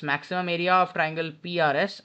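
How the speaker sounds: normal talking voice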